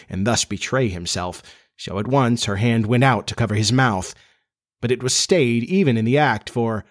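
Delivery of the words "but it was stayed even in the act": ordinary delivery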